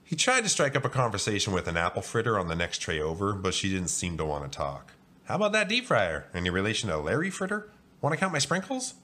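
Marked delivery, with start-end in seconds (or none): 5.2-8.9 lightly voiced eager little donut, pitched up; high, perky opener to a stranger; chatty donut. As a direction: pipe up high with friendly small talk. Chatty donut making small talk.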